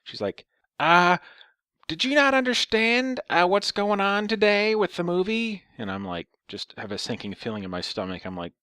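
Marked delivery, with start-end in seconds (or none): 0.8-5.6 high, slow mock old woman; caricatured old lady, pitched far up and drawn out. Old lady calls, retold.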